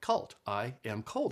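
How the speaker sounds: ich bin kalt spelled out slowly, each word apart; slow, careful demo. Shown as a demonstration. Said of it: "that's how you say it".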